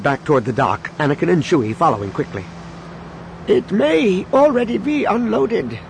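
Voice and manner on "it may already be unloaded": put-on old man calling out; calling after. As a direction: call it after them. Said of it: "called after them".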